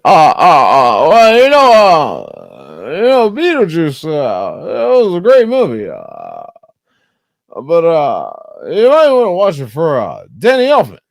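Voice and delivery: high, loud halting Obama voice; halting Obama-style pauses, loud and high; Obama impression. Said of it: "Sound like Obama and shit".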